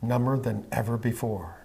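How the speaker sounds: slow, soft, even hypnotist voice; hypnotic induction. Acted. Hypnotist guiding a trance.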